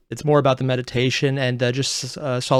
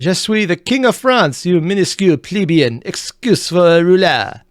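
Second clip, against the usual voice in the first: a hammy, higher mock French accent; bad French accent; 5 semitones higher, 4 dB louder than usual. Playing King Philip to the pope, a bad mock French accent. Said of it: "Yes, that is my French accent".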